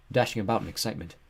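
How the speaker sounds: usual speaking voice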